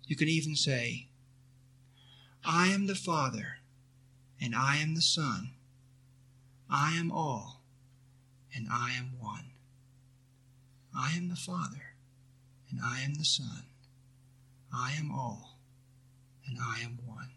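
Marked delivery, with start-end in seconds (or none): 2.4-9.5 a soft, reverent recited prayer, lightly voiced; quiet affirmation. Teacher reciting a mantra to students, softly and reverently. 10.9-17.3 softer, hushed reverent recitation fading away; fading prayerful repeat. Acted. Teacher repeating the mantra to students, hushed and fading.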